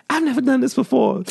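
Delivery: high breathy mock innocence; coy girl voice. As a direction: play the shy girl. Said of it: "she just, like".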